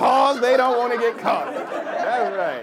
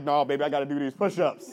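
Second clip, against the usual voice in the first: put-on boyfriend; 7 semitones lower, 3 dB quieter, faster than usual. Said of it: "And you're like".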